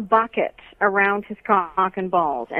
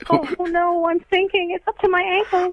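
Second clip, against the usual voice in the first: high, panicked pretend sinking; sinking in quicksand; 8 semitones higher than usual. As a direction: play-act drowning in quicksand, rising fear. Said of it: "can we role play some quicksand real quick".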